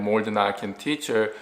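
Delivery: ordinary talk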